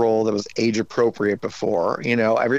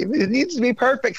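mock perfectionist; 12 semitones higher than usual. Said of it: "I'm like".

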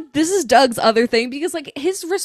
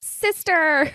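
a raised, gleeful one-word cheer; mock cheer; 6 semitones higher than usual. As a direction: cheer on the sisters. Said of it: "and I was like".

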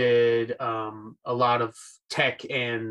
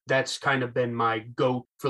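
ordinary talk, nothing marked

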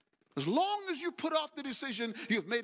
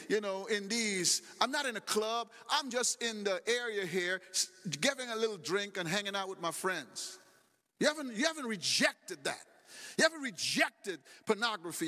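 ordinary talk

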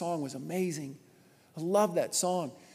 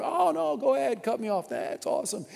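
high mock-polite sarcasm at a rude driver; sarcastic driver; 7 semitones higher than usual. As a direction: sneer it sweetly, like a fake thank-you to someone cutting you off.

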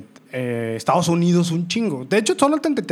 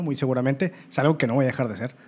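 normal talking voice

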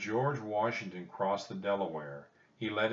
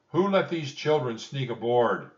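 loud, raised, stern questioning; stern demand; 6 semitones higher, 10 dB louder than usual. A narrator's reading. George Washington questions the rowers, stern demand. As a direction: demand who is responsible, sternly and loudly. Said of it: "he asked in a stern voice".

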